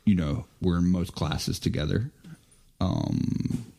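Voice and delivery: ordinary talk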